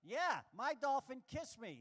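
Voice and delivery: ordinary delivery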